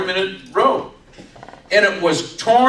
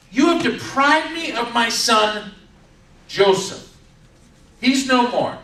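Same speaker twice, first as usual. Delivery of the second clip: acted losing it; 7 semitones higher than usual. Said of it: "this is where Jacob just loses it".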